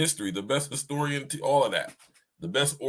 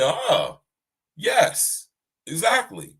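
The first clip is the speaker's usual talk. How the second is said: high, delighted-discovery exclamations; surprised approval; 4 semitones higher, much slower than usual. Retold or imitated. Most High answering his guess, surprised. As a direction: imitate someone surprised by a right answer. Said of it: "As if the Most High was surprised".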